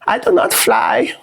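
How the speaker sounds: high, slow squeaky-girl impression; mock a young activist in slow falsetto; exaggerated, very high-pitched mocking voice; Greta Thunberg. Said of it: "That's how she sounds".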